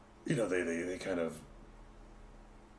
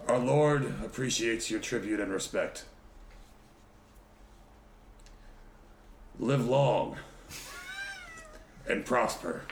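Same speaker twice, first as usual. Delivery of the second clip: loud, slow and solemn, like a lord's herald; grand proclamation; 6 semitones higher, 4 dB louder, slower than usual. Acted. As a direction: proclaim it grandly to kneeling warriors. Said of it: "And I say in their language".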